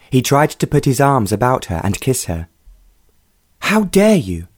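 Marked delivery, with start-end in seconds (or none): 3.6-4.5 high, indignant outburst; furious exclamation. A narrator's reading. Young lady to a pushy suitor, furious. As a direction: throw it back at him in outrage. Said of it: "she exclaimed furiously".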